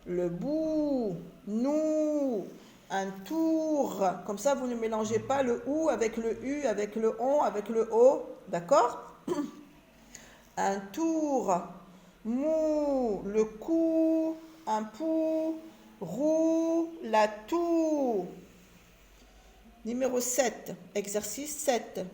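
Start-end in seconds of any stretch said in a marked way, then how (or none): 0.1-4.1 each "ou" sustained, then falling, as in "nous"; slow demonstration of long "ou" in "bout", "nous", "tour"; elongated vowel. Shown as a demonstration. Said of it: "on allonge un petit peu le son de la voix". 10.6-18.3 high sustained "ou" on each word, "mou" to "toux"; slow chant-like drill stretching "ou" in "roue"; elongated vowel. Shown as a demonstration. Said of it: "on allonge un petit peu le son de la voix".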